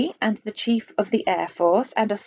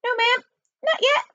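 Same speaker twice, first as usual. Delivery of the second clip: nervous high falsetto spy. A narrator's reading. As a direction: squeak out a meek reply. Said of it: "and a falsetto voice".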